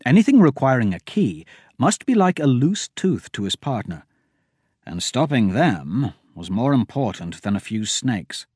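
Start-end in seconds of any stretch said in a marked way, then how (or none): none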